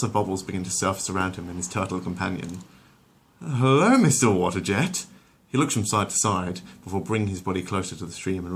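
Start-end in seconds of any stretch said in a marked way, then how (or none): 3.4-5.1 higher, louder delighted hello; charmed greeting. Acted. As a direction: greet the bubbles happily. Stewie greets the jet.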